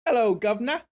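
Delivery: high, loud hammed-up British greeting; cheeky Cockney-style hello; mock British accent. Repeating the British gag.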